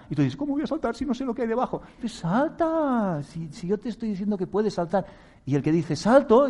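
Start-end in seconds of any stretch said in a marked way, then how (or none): 0.4-1.8 high, fast, anxious objection; whiny doubter. Acted. Someone told to jump by life, high anxious objection. 2.0-5.0 insisting voice. Acted. Life's voice insisting. 5.9-6.5 loud, high "salto!"; bold shout. Acted. The brave one jumping off the branch, loud shout.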